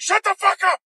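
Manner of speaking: high, loud mock yell of frustration; angry yell. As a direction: yell at a cheering crowd to shut up. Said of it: "Easton was like".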